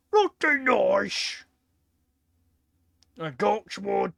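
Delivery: loud, high, show-off orc hollering about his guns; bragging orc voice. Retold or imitated. Streamer voicing a show-off orc bragging to others, loud and high. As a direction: boast like a cocky little orc showing off his loot. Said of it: "just going to other orcs".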